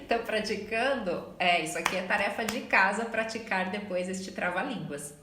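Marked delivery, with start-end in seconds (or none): none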